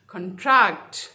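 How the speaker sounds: con-TRACT, loud second syllable; verb stress. Shown as a demonstration. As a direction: hit TRACT hard in contract. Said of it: "stress second syllable पे होगा".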